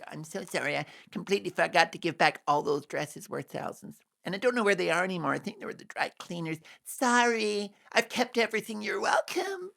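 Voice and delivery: hammed-up Meghan impression ending very high on welcome; mock Meghan. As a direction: play it as an airy insincere excuse. Mock Meghan excuse about dresses.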